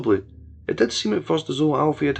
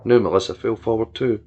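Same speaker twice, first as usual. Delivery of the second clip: ordinary delivery, nothing marked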